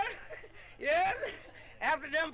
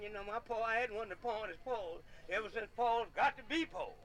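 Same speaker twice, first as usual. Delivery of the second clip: normal talking voice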